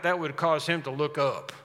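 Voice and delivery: ordinary talk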